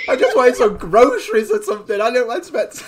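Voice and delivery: loud, high mock protest through laughter; hammed-up bewildered complaint; flustered bystander. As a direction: play a put-upon driver, laughing as you go. Acts out startled driver, laughing.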